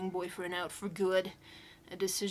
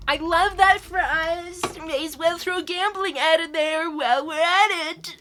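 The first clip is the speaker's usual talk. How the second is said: sarcastic; 7 semitones higher, 8 dB louder than usual. Real, not acted. Sarcastic remark on the ad.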